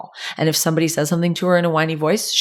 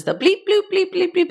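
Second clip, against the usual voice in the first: high, sing-song imitation of electronic toy beeps; 14 semitones higher than usual. Parent imitating annoying toy noises, high chirpy beeps.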